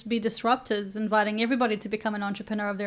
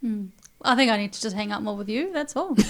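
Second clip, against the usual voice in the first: ordinary talk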